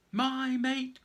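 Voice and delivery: high, loud grieving cry; anguished cry. Acted. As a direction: call out for a lost mate. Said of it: "cried another".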